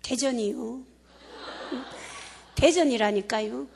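put-on laid-back Chungcheong accent, in no hurry; Chungcheong dialect announcement. Speaker pretending the Daejeon PA speaks in a relaxed Chungcheong accent. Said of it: "충청도나 대전 쪽으로 가면 ... 이렇게 하죠".

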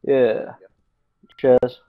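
usual speaking voice

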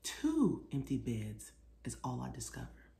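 lively picture-book read-aloud; reading to children. A narrator's reading. Author reading her book aloud to children, lively. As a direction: read it like storytime for little kids.

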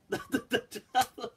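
ordinary talk

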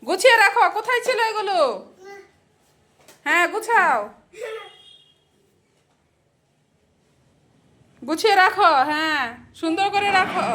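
high, insistent mum voice. Real, not acted. Mother to toddler tidying the mess, chiding. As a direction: tell a little one firmly to tidy up.

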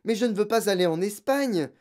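put-on high, upset child's objection; girl pleading urgently; pleading protest. A narrator's reading. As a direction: protest that you want to stay. Girl protesting against leaving.

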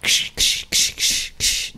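whispered ksh ksh of boots in deep snow; crunching snow noise. Retold or imitated. Kids sneaking through snow, whispered crunch sounds. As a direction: hiss out each footstep like crunching snow.

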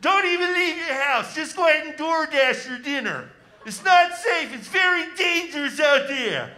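preachy public-health warning, hammed up; Doctor Fauci impression. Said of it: "This is Doctor Fauci".

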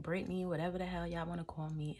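normal talking voice

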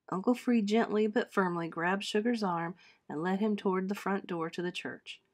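usual speaking voice

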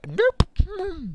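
sudden high-pitched nope; concentrating voice. Real, not acted. Gamer focused on a tricky jump, squeaks a high nope. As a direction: yelp it out way up high. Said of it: "This is my concentrating voice".

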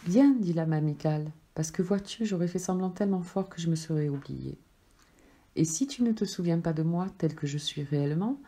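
ordinary delivery, nothing marked

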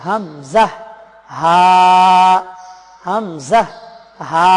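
হামজা and হা sounded from the start of the throat; throat-start articulation. Shown as a demonstration. Teacher demonstrating letters for viewers, deliberate throat-start articulation. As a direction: recite হামজা, then a long হা, each placed at the throat's start. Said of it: "হলকের কণ্ঠনালীর শুরু থেকে".

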